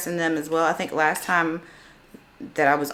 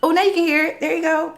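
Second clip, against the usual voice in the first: surprised; 10 semitones higher, 5 dB louder than usual. Real, not acted. App audio finally plays.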